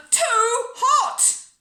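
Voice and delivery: loud, high, drawn-out wail; tantrum complaint. A narrator's reading. Bella objects to her peas at tea, wailing loudly. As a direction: wail both words in protest.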